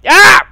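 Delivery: loud, high shriek; startled scream. Real, not acted. Nearly dying in the game, loud high shriek. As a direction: yelp as you nearly die. Almost died falling.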